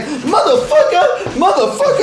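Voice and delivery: high, loud cursing through laughter; man knocked into by a drunk cursing loudly; angry cursing. Retold or imitated. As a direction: curse him out like a shoved stranger. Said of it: "And the guy's like".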